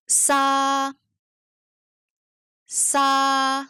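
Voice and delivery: drawn-out ça, said twice with space between; whole syllable drill. Shown as a demonstration. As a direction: hold ça on an even pitch for repeating. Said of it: "en prononçant d'abord la syllabe entière deux fois".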